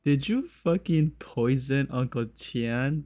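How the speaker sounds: usual speaking voice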